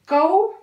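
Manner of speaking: teacher calls out कौ; loud demo of कौ. Shown as a demonstration. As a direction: model कौ for the class. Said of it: "okay".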